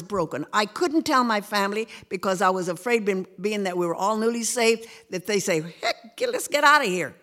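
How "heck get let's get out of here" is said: exasperated exit. Retold or imitated. Imagined family reaction.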